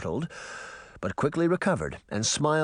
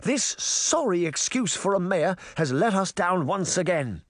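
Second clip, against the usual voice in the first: high, imperious speech to a crowd; haughty Queen of Hearts, ranting; 8 semitones higher than usual. Acted. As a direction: whip the townsfolk against the mayor.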